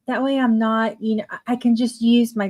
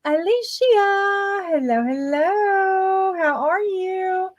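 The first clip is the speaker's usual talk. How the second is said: higher, slow, singsong hello; sing-song greeting; 9 semitones higher, slower than usual. Real, not acted. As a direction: sing out a welcome. Greeting viewer on live stream.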